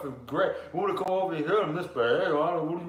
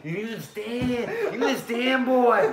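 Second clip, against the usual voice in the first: creepy Louisiana guy; 6 dB louder, slower than usual. Acted. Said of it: "That's creepy Louisiana guy for you guys".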